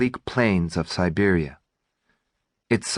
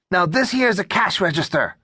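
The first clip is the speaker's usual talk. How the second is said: high squeaky robber voice; 10 semitones higher than usual. A narrator's reading. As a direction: pitch it up, thin and squeaky. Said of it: "raising his squeaky voice".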